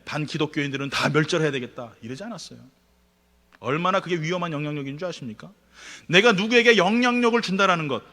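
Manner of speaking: normal talking voice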